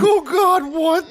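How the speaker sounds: high, cracking alarmed cry; alarmed dad. Retold or imitated. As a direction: squeak it out in alarm. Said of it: "He's like".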